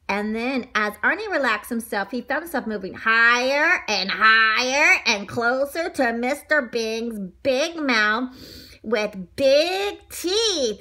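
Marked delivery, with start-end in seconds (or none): none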